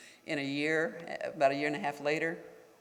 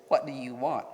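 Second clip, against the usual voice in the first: curt demand. Shown as a demonstration. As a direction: replay the official's brusque line.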